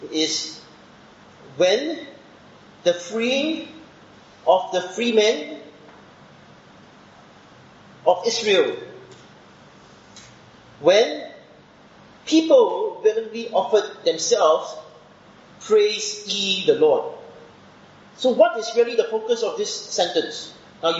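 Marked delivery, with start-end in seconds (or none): 1.6-8.8 reading each word out, raised and measured; slow literal reading. Shown as a demonstration. Teacher to students, reading the verse literally, slow and raised. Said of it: "if you want to be very literal about it". 10.8-17.1 raised, slow, deliberate reading of the verse; slow literal reading. Shown as a demonstration. Teacher reading the Hebrew word order aloud, slow and emphatic. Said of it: "if you want to be very literal about it".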